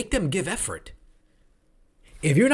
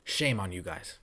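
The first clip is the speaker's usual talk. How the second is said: muttered shame; 11 semitones lower, 10 dB quieter than usual. Real, not acted. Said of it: "repeated scolding".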